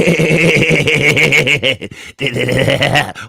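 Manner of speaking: long, loud laugh bursting out; loud burst of laughter. Real, not acted. Cohost reacting to the growl impression, loud laughing fit. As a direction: crack up loudly at the growl.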